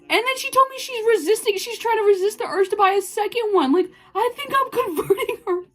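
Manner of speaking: high, squealing fangirl gush; high-pitched thrilled disbelief; giddy excitement. Real, not acted. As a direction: gush it high, breaking into laughter. Thrilled friend is getting hooked.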